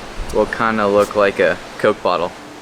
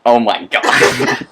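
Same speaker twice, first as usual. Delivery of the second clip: slow drawn-out pained groan; hit my funny bone; 4 dB louder, much slower than usual. Real, not acted. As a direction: drag it out, still hurting. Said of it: "hit my funny bone".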